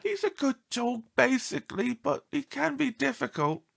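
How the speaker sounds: worried lady lightly voiced; upset owner. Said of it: "Missus LaRue said".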